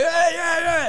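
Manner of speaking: high, loud whooping yeahs; cheering. Retold or imitated. Friends whooping during the fight, cheering. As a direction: whoop like a rowdy crowd.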